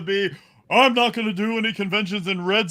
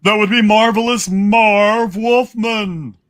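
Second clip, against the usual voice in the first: grand announcer; 2 semitones higher, 10 dB louder than usual. Acted. Announcing comic credits grandly.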